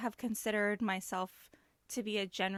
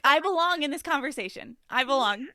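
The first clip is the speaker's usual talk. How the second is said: loud assertive; 5 semitones higher, 9 dB louder than usual. Retold or imitated. Mock self-important inner voice.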